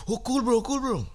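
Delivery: high, nervous placating voice; mock scared placating. Frightened men placating the angry neighbor, high and nervous. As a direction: soothe him quickly, high and scared.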